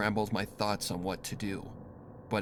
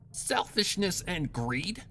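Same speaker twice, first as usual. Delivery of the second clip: higher, slow, scornful repeat of "Selfishness and greed?" with a rising tune; mocking echo; 10 semitones higher, slower than usual. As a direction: toss her words back with disdain. Dash mockingly repeats her words.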